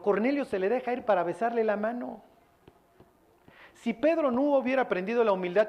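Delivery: normal talking voice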